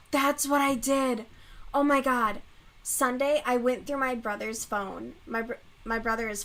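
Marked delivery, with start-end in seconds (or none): 0.1-2.4 louder, excited realization; excited outburst. Real, not acted. Telling viewers what she did, excited. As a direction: blurt it out, suddenly excited.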